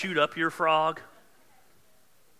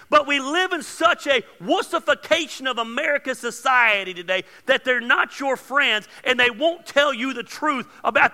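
much higher and louder, preaching hard at the viewers; full-force preaching; 10 semitones higher, 13 dB louder than usual. Acted. Urging viewers to change before the new year, fiery preaching. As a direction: hammer the reality check home like a pulpit.

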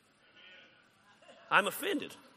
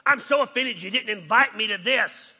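loud, higher, indignant mock whine; mock offended whine; 6 semitones higher, 9 dB louder than usual. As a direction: ham up a hurt, offended friend. Mocking an offended person loudly.

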